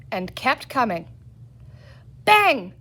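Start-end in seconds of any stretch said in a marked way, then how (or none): 2.2-2.7 high, loud pretend gunshot; bang cried. A narrator's reading. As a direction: shout bang like a cap gun. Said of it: "said Peter again".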